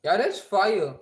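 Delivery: loud hyped approval; excited. Real, not acted. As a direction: belt out the praise. Hyped reaction to audio.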